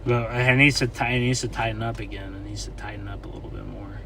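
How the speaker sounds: hushed, half-whispered commentary; whispering. Real, not acted. Narrating quietly while filming the storm.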